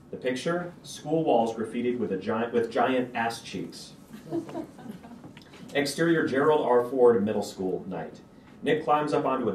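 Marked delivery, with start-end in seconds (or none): 4.1-5.3 a high, quiet laugh breaking through; a short giggle at the joke; brief laugh. Real, not acted. Reader laughing at the graffiti line.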